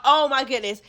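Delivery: normal talking voice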